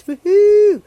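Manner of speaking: high, loud mock cheer; a quick whoop of excitement; excited cheer. Cheering about contestants.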